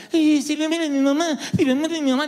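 high, wailing self-pity; mock panicked whimper. Preacher mocking his own panic over his mother. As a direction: whine it like a scared boy. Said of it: "ahora estaba como".

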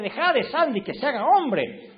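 a hammed-up dad telling mom off; imitating stern dad. Father telling mom to stop spoiling the son, mock stern. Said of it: "Papá decía".

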